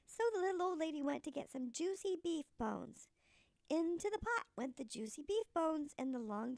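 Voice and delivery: ordinary delivery, nothing marked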